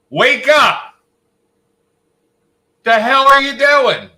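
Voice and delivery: loud, high calling shout; yelling to wake him. Real, not acted. Yelling at sleeping Adam to wake him up, loud calls. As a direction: bellow his name to rouse him from sleep.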